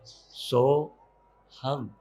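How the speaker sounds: soft, low so-ham with long gaps; hushed meditative mantra, one syllable per breath. Shown as a demonstration. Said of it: "बस इसी में अब मंत्र जोड़ लो".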